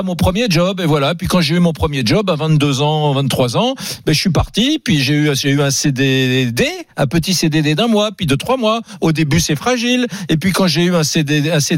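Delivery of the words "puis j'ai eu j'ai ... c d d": ordinary talk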